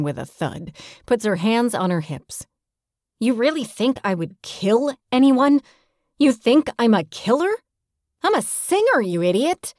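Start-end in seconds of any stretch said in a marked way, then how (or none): 3.2-9.7 high-pitched, incredulous outrage; hurt, exasperated protest; indignant. Acted. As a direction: fire back offended, pitch leaping up in disbelief. Indignant at being suspected of killing.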